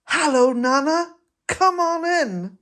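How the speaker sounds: lightly voiced toddler, laughing; little girl's greeting. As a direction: play the two-year-old inviting Nana in. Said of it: "and she said".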